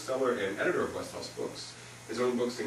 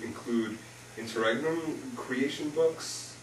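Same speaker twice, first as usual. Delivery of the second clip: ordinary talk, nothing marked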